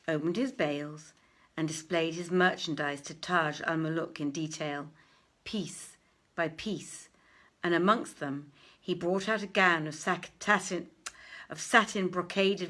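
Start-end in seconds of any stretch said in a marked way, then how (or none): none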